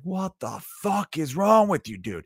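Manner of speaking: high, slow outraged yell; outraged crowd. Retold or imitated. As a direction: shout it in disbelief as the crowd. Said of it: "they were like".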